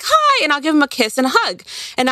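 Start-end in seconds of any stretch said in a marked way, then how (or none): 0.0-0.4 bright, much higher mock hello; sing-song hi to a stranger; cheery greeting. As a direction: greet a stranger like an old friend. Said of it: "I'll be like".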